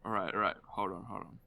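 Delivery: usual speaking voice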